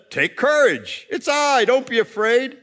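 acted emphatic encouragement; calling Jesus. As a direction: call out reassurance across the water. Acts Jesus calling to the disciples.

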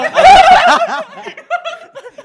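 high howling laugh; loud burst of laughter. Real, not acted. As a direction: shriek with laughter. Big laugh at joke.

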